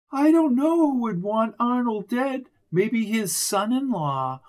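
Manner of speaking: lightly voiced grieving widow. A narrator's reading.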